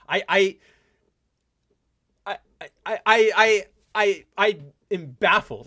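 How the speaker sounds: real stutter from disbelief. Real, not acted. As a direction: trip over the I's, baffled. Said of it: "five seconds of stuttering 'cause I'm baffled".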